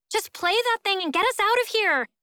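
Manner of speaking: high, urgent call; calling back. A narrator's reading. Frozen girl urges guitarist to play, calling back. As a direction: call up to the window, pitched high. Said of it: "Jingfei calls back".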